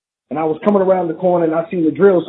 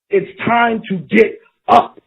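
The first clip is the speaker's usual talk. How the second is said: slow, lifted rallying call; preacher rousing the downcast congregation, slow and lifted; 4 semitones higher, slower than usual. Acted. Lift the words slowly to rally them.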